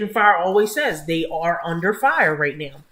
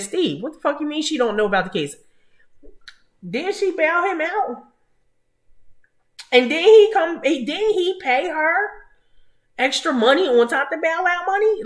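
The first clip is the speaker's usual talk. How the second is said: exasperated; 8 semitones higher than usual. Real, not acted. Angry rant at lawyer.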